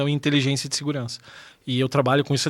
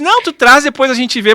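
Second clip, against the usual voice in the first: polite brush-off; 9 semitones higher, 9 dB louder than usual. Retold or imitated. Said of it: "que tu disse".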